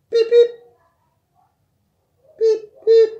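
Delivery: very high, loud beep noises. Acted. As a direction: squeak beeps like a toy. Imitating toy beeping for child.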